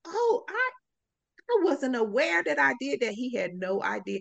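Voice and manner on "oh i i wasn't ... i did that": surprised boss. Retold or imitated. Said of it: "And he said".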